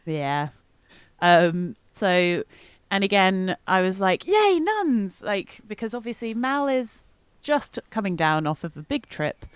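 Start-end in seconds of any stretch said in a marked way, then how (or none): 4.3-5.2 mock delight. Mal's delighted reaction retold.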